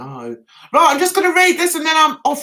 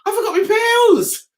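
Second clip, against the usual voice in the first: louder, higher nervous fretting; mock nervous fluster over the pills; fretful; 7 semitones higher, 5 dB louder than usual. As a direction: replay the panic, louder and higher. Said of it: "And I said".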